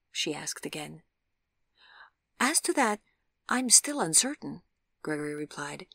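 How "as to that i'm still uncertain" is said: higher, politely tentative; a careful, courteous hedge; formal uncertainty. A narrator's reading. Visitor answering whether they have met, politely unsure. Formal hesitant reply to girl.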